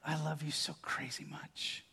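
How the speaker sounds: very soft whisper into a grandchild's ear. Retold or imitated. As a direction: murmur it tenderly, barely voiced. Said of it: "I'll whisper in their ear".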